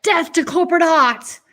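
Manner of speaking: loud rallying shout; yelling. Acted. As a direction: bellow it like a protest chant. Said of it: "the big guy in front yells".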